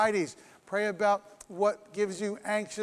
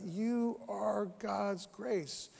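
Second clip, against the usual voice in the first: hushed emphasis; 6 dB quieter, much slower than usual. Acted. Preacher telling listeners they are grace, soft and slow. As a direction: let it land softly and slowly. Repeats key line.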